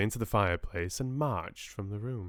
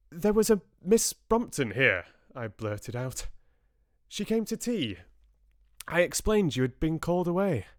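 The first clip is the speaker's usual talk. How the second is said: blurted out; 7 semitones higher, 3 dB louder than usual. Acted. Narrator blurts to Farnan.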